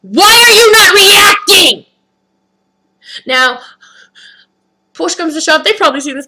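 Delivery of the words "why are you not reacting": loud, high, slow demand; exasperated shout. Retold or imitated. Customer to staff who will not react, exasperated shout. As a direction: shout it in disbelief, stretching each word.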